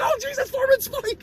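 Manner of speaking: high-pitched fan astonishment; imitated marking out. Teasing co-host over spotting a cameo, high mock-excited voice. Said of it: "you marked out. You're like".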